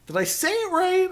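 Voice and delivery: unsure question. Retold or imitated. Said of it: "I was like".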